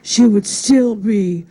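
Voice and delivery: slow, halting, tearful eulogy voice with catches between words; Howard Stern choking back tears. Retold or imitated. Howard Stern at Joan Rivers' funeral, choking back tears. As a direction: do a low, choked-up Howard Stern fighting back sobs. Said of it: "he's choking back tears".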